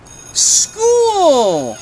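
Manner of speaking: high, loud drawn-out cry; joyful shout. Acted. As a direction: announce it with delight. Trixie arriving at school.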